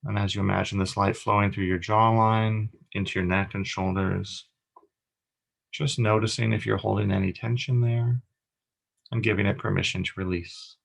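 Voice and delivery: calm meditation guide with long pauses; guided meditation. Acted. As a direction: guide them gently through each breath, leaving space between phrases.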